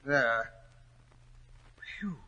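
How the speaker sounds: ordinary delivery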